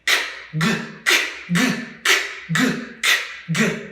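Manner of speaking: voiceless "k", then hard voiced "g"; short back-of-mouth drill on "k" and "g"; alternating K and G sounds. Shown as a demonstration. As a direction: switch between "k" and "g" as bare consonants. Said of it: "the K sound, then the G sound".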